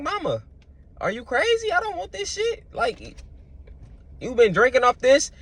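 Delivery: put-on high, indignant little-kid voice. Young me refusing mama's leftovers, high kid voice. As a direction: play the picky kid refusing food, high and squeaky. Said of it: "I was a kid talking like this".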